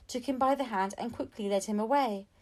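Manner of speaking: usual speaking voice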